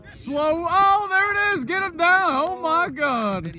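loud, high excited shouts; excited yelling. Real, not acted. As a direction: cheer the play on at full voice. Excited watching a big play.

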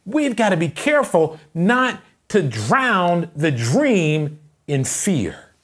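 loud, high, impassioned sermon shout; full-force preaching. Real, not acted. Pastor warning the church about opposition, full-force preaching. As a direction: preach it at the top of your voice.

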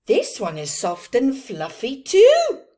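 loud excited exclamation; bright storybook exclamation; exclaiming. Acted. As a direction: exclaim it with delight. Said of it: "they both exclaimed".

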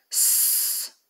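a long unvoiced snake hiss; admiring hiss. The boa hisses at the silver egg, admiring. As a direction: let out a slow snake's sss of wonder. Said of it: "syknął z podziwu".

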